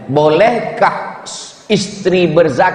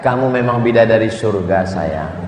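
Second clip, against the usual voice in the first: sweet-talk; 7 semitones lower than usual. Retold or imitated. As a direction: coo it to win her over. Husband sweet-talking wife.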